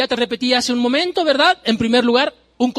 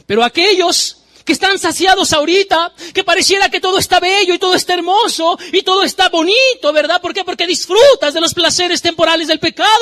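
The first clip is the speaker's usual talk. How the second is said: louder, higher preacher's cry of woe; preaching at full force; 9 semitones higher, 6 dB louder than usual. Acted. Preacher crying woe over those who ignore the eternal, loud and high. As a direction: warn the sinners from the pulpit.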